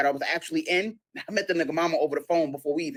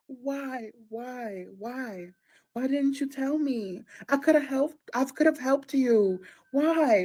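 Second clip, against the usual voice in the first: high, drawn-out mock pleading; play-acted distraught girlfriend; 8 semitones higher, much slower than usual. Girlfriend playing her part on the phone, mock-distraught. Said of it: "I gotta play the distraught gir".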